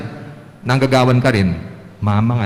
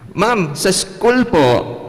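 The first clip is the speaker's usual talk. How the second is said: much higher, very slow little pupil; child's answer; 6 semitones higher, much slower than usual. Pretend. Pupil answering the teacher, high and slow. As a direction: answer like an eager schoolkid.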